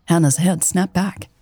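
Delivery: ordinary delivery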